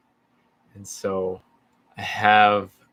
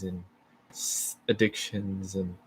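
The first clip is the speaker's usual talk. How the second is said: ordinary delivery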